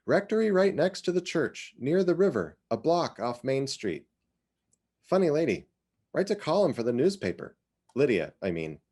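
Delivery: put-on chatty older woman sharing local gossip; lightly voiced Iz. Iz tells Cynthia about Lydia, lightly voiced chatty neighbour. Iz giving directions.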